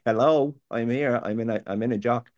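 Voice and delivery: exasperated loud calling out; loud complaint to God. Retold or imitated. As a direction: shout your complaint upward, demanding an answer. Demanding God show up.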